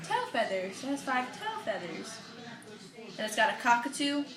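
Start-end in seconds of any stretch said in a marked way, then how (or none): none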